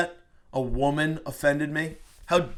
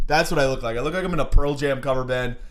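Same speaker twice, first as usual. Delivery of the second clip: ordinary talk, nothing marked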